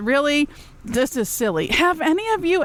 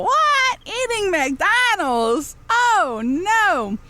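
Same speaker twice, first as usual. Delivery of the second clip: higher squeal of hammed-up surprise, slow on McDonald's; mock shock; 9 semitones higher, much slower than usual. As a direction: play up the disbelief for laughs.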